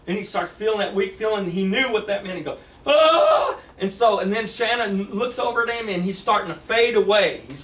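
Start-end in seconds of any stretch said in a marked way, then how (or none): 2.9-3.6 high loud sudden cry; cry of alarm. Acted. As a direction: cry out as you realise you're vanishing. James knows he is fading.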